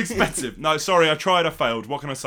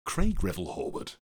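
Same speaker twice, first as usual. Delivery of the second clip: Craig Revel Horwood voice; 5 semitones lower than usual. Retold or imitated. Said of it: "Nah, that's rubbish".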